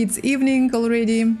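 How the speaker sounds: ordinary talk, nothing marked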